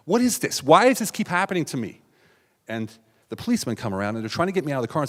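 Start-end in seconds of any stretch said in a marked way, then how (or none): 0.1-2.0 high-pitched cry of frustration; acted-out despairing exclamation; exasperated outburst. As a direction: plead with the universe, pitch way up.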